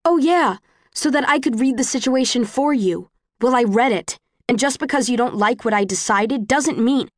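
high, biting sarcasm; angry. Acted. Quarrel on the train.

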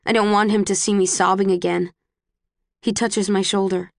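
ordinary delivery

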